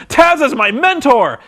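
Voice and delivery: mock fired-up declaration. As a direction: play Joe suddenly inspired. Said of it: "like he's fired up".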